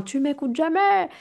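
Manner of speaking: a hint of hurling "jamais" at someone; mock yell. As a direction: act out a blaming shout without real force. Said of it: "Au lieu de hurler à quelqu'un".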